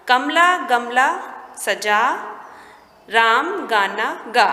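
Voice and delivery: word by word, with clear gaps; teacher modelling each word; reading-primer delivery. Shown as a demonstration. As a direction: read it out slowly for beginning readers. Reads practice words aloud.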